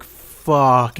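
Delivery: long, drawn-out curse of dawning dread; slow-motion oh-no moment. Retold or imitated. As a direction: stretch the word out as in slow motion. Retold dread as match drops.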